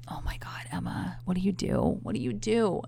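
unvoiced panicked whisper to herself. Whispering to herself after her date's side hug. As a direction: hush it like a private panic.

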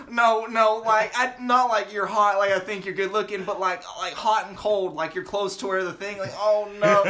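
high, loud imitation of embarrassed explaining; imitating someone frantically taking it back; mock flustered backpedal. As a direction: stumble over a hammed-up retraction. Acting out coworker backpedalling, embarrassed.

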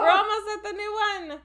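excited shout. Real, not acted. Excited shout near end.

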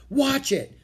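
high-pitched warning cry; urgent warning. Acted. Jack warns as something falls from above, urgent warning. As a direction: call out to make them duck.

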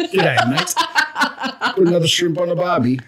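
hammed-up, high-pitched Aussie, half laughing; strongest Aussie accent. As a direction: lay the Australian accent on thick, jokingly. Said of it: "strongest Aussie accent".

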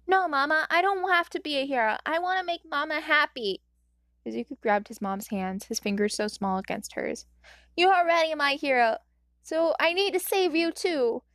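put-on high, firm childish resolve; determined little boy. A narrator's reading. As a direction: promise to save mama earnestly.

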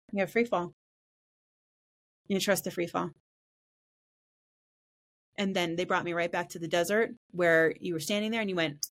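normal talking voice

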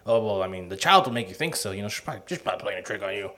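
lightly voiced condescending doctor; dismissive doctor. Voicing dismissive old doctor.

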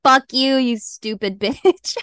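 a loud, mock yell, slowing at the end; mock yelling curse. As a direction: retell the friend's sleep-yelling, half-acted. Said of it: "waking up yelling".

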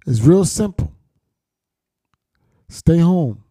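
usual speaking voice

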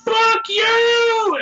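high, loud joking yell; mock outburst. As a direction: shout it at a friend in fun.

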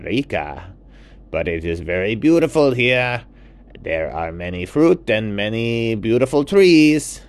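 a warm host showing off his jungle; toucan Juan. Acted. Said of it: "And the toucan said".

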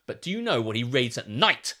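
ordinary talk, nothing marked

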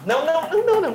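rising panicked no; frantic denial. Acted. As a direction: cry no over and over, high. Rejecting each guess, agitated.

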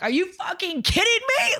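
high-pitched, incredulous outburst; outraged retort, pitched well above normal talk; retold fury. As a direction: re-enact an indignant comeback, pitch jumping up.

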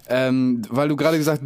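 usual speaking voice

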